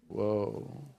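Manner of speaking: quiet, hushed whoa; soft whoa. Real, not acted. Pastor reacting to his own point, quiet whoa.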